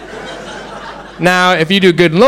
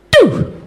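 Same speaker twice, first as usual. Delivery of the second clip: one sharp high doo; crossing signal beep. Retold or imitated. Comic recalling the first crossing beep, high sharp doo. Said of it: "the first thing I hear is".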